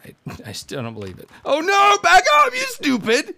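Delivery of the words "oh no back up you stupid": very high, loud frantic yell; panicked yell. Real, not acted. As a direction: scream at the dumb character. Panicking at game death.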